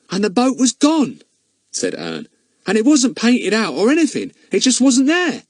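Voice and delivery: put-on high, earnest country boy; a boy insisting on what he saw; boy Ern. Ern telling the superintendent the boat vanished, earnest.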